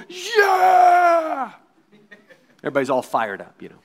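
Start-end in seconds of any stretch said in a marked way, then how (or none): 0.1-1.5 loud high cheering shout; team cheer. Retold or imitated. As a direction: roar back like a fired-up team. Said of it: "And the whole team".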